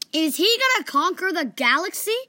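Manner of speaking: high, loud worried question. A narrator's reading.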